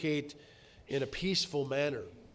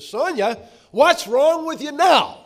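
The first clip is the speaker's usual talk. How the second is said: sharp, much higher and louder, slowing on the blame; harsh words; 12 semitones higher, 14 dB louder, slower than usual. Retold or imitated. Husband snapping harshly at his upset wife. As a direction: snap at her with exasperated blame. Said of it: "But harsh words like".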